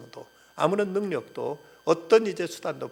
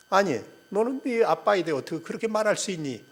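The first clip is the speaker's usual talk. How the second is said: mildly angry retort, raised pitch; mock indignant; 6 semitones higher, 4 dB louder than usual. Mother snapping back at her daughter in a joke, mock indignant. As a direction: imitate an offended mother, higher-pitched. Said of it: "화를 내더랍니다".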